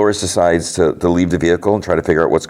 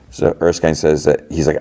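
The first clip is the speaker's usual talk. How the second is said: ordinary talk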